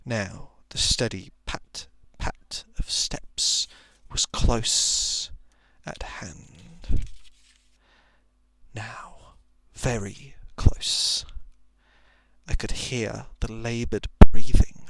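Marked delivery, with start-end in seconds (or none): 0.0-11.2 slow, hushed whisper as the steps draw near. A narrator's reading. Narrator hearing the beast pad closer in the dark, whispered. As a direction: hold your breath and whisper each step.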